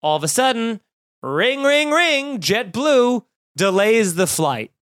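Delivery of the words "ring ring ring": high sing-song ring ring; playful high-pitched ringing imitation; mock phone ring. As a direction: chirp it like a phone notification.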